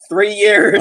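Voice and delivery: loud, high outcry on YEARS; loud exclaim. Real, not acted. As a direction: shout Three years in disbelief. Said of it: "Three years of Spanish".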